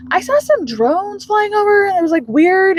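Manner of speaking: high, put-on casual remark; feigned innocence. [name] fishing about drones with Sam, high feigned innocence.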